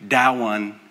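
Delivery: "That one" said as "dah-wun"; toddler pronunciation. Retold or imitated. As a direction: imitate a toddler's "That one", hard d and no final t. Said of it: "but it comes out as".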